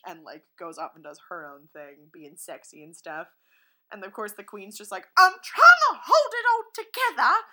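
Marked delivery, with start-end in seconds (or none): 5.2-7.5 very high, loud strained queen; hammed-up frazzled queen; mock queen voice. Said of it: "That's not how she speaks at all".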